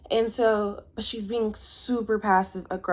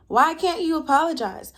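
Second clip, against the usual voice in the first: higher-pitched mock pleading question; imitated insistent demand; 8 semitones higher, 3 dB louder than usual. Girlfriend demanding an apology from boyfriend, imitated arguing. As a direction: press the question in a raised pitch. Acted couple's argument, repeated demand.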